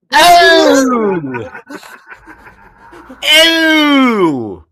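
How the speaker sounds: high loud grossed-out shriek; disgusted cry. Real, not acted. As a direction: recoil with a loud ew. Disgusted at hand.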